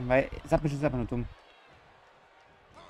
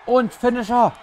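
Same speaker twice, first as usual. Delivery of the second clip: excited call; 7 semitones higher, 8 dB louder, slower than usual. Real, not acted. Announces his finishing move.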